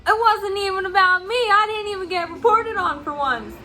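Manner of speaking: high, loud protest from the coworker; retold coworker yelling. Coworker yelling back at the director, high loud protest. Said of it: "he starts screaming at her".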